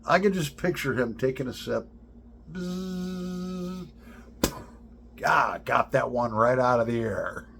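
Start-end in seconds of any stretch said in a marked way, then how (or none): 2.5-3.9 fly buzz. Retold or imitated. Imitating fly before shot.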